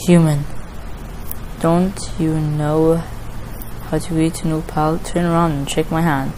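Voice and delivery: a hint of an ominous stranger; game character Sans. Reads Sans's lines aloud in game.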